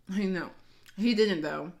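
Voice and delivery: ordinary talk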